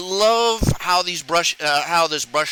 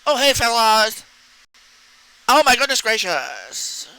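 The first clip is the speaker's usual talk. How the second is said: higher, slow, sing-song camp voice; flamboyant camp greeting; 5 semitones higher than usual. Pretend. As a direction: drag out the oh like a sassy friend. Mocking stereotypical flamboyant speech.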